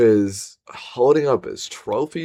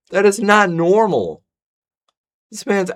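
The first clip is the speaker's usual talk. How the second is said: outraged; 6 semitones higher than usual. Real, not acted. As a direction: call it out, outraged. Outraged at the murder video.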